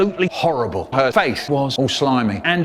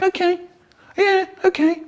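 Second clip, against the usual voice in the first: much higher squeaky little voice; meek George. Pretend. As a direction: squeak it out like a cowed sidekick. George meekly gives in.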